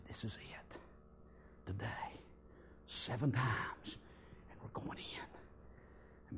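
soft, slow whispered command. Retold or imitated. Joshua giving the secret order, slow whisper. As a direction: whisper it so no one hears. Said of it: "just quiet as mice".

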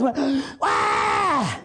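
gasp, then a mock baby wail; hammed-up newborn gasp and wail; newborn's first cry. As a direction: play the newborn's first breath and squall. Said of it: "I drew my first breath".

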